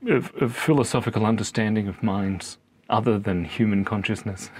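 usual speaking voice

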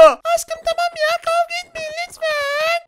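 put-on squeaky high-pitched spider girl, slow and sweet; girly high spider girl voice. (çok ince sesli örümcek kız)

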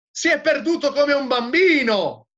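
high, loud heckle from the crowd; mocking shout. A narrator's reading. One of the unbelievers jeering at the madman, mocking shout. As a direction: call it out loudly over the confusion. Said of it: "Gridavano e ridevano".

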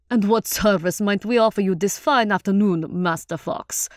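rich-voiced courteous performer; hearty, formal character voice; genial troupe leader. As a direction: offer your services with showman's flourish.

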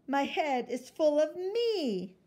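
high, loud and slow; proud child voice. Acted. Girl tells her mother proudly, high and slow. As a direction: savor every word with pride.

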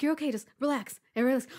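retold urgent reassurance. Retold reassurance to hurt sister.

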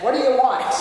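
a touch of a grumpy innkeeper; mock-gruff question at the door; boy playing a grouchy innkeeper. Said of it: "he opened the door grumpily and said".

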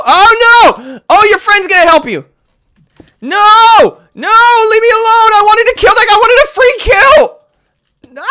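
high loud frantic yells; frantic screaming. Real, not acted. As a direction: scream in frustration. Screaming as killed.

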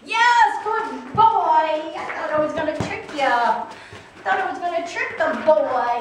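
high, loud excited dog praise. Real, not acted. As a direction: gush over the dog.

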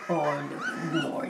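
normal talking voice

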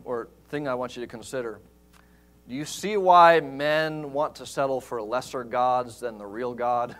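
ordinary delivery

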